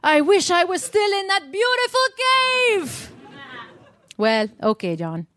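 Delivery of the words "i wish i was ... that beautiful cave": melodramatic wail. Acted. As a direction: wail it like a soap heroine. Dramatic lament for the cave.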